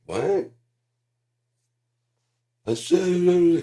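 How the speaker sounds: mock stage shout.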